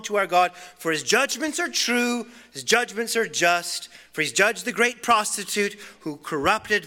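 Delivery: loud cry of praise. Acted. Preacher voicing heaven's multitude for the church, loud triumphant praise. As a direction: proclaim it like a multitude shouting in heaven. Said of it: "crying out".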